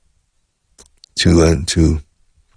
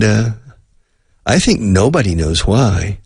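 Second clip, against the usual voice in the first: ordinary talk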